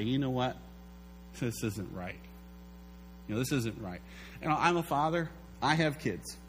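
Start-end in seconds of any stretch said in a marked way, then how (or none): 0.0-4.0 much lower, softer bitter murmur; inner complaint. Pretend. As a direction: grumble it inwardly to yourself. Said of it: "in your heart, you say".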